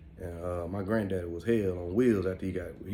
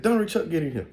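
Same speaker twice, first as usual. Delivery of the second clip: higher, louder call to the back room; grandma calling out; 8 semitones higher, 6 dB louder than usual. Retold or imitated. Grandma calling her sons in, loud and high. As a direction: summon them like a grandma who means it. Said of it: "She's like".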